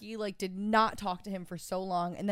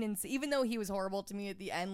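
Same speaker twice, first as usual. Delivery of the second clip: ordinary delivery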